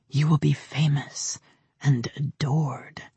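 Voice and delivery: seductive whisper. Acted. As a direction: whisper it like a tempter. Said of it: "a seductive male voice whispered inside her skull".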